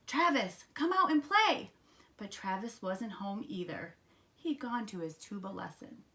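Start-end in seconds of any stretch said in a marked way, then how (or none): 0.0-1.7 put-on high, loud kid-frog call. A narrator's reading. Froggy outside Travis's house, high hollered call. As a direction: holler to a friend indoors.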